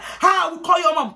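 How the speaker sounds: high, loud scandalised warning; threatening auntie. Retold or imitated. Auntie threatening to phone the girl's mom, high loud warning. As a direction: imitate an auntie's outraged threat.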